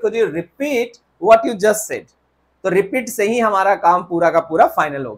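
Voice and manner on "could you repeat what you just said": slow model question; slow corrected example. Shown as a demonstration. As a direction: say the fixed question slowly. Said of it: "नहीं sir, हम कहेंगे".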